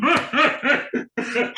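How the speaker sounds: high delighted laugh; high burst of laughter. Real, not acted. Laugh at Miyagi story.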